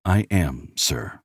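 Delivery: understated humble reply; humbly. A narrator's reading. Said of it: "Nicholas said humbly".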